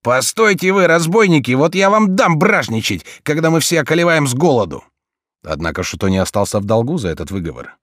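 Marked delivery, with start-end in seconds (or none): 0.0-4.8 loud, high indignant scolding. Acted. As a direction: tell off the looters hotly. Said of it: "Поручик Роша возмутился".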